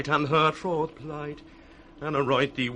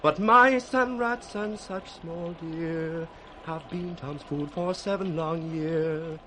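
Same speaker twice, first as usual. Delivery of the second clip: normal talking voice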